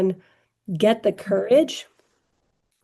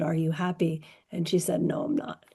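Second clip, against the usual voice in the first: soft and low-key; barely above a murmur; hushed; 3 semitones lower, 8 dB quieter than usual. Retold or imitated. Quiet recalled breakup question.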